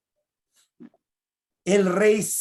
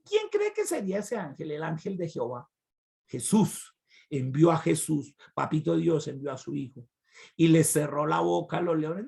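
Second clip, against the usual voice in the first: ordinary talk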